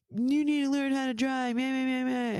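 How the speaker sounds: high, whiny mock complaint; taunting singsong imitation; mocking mimicry. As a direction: parrot the driver in a silly high voice. Mocks the driver's complaint.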